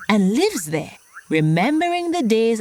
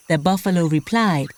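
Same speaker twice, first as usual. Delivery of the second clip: usual speaking voice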